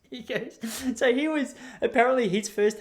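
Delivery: high-pitched, talking through laughter; real laughing. Real, not acted. Comedian resumes tattooist's story after the punchline, laughing. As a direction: struggle to resume the story while cracking up.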